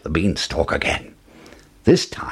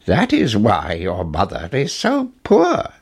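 ordinary delivery, nothing marked

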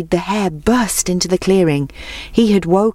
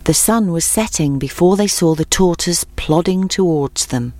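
usual speaking voice

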